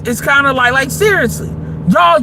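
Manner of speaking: normal talking voice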